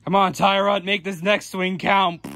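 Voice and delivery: usual speaking voice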